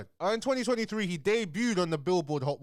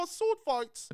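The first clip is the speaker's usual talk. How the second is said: mock posh British; much slower than usual. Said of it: "it's like".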